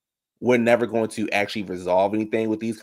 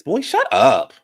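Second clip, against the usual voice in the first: louder, higher retort; dismissive outburst; 5 semitones higher, 8 dB louder, slower than usual. Real, not acted. Host answering a commenter, loudly dismissive. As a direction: cut him off loudly. Snaps back at a viewer comment.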